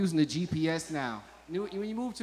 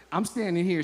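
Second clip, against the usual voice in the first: usual speaking voice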